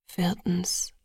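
soft, slightly low murmur; hushed, intimate aside. A narrator's reading. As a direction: murmur it close by. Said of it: "murmelte er".

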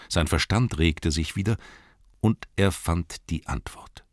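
ordinary delivery

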